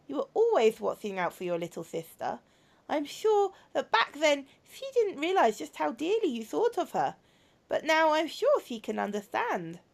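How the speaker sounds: softly earnest, sorrowful confession; robot girl confessing to her brother. Acted. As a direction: play a troubled robot girl, gently. Voices a robot girl in game.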